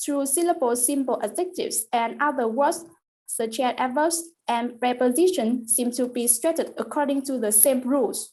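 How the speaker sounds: ordinary talk, nothing marked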